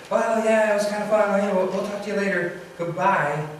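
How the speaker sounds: high, breezy sign-off; upbeat goodbye. Acted. Suicidal friend ending a goodbye call, upbeat. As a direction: wind up the call cheerfully. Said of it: "you seem really upbeat".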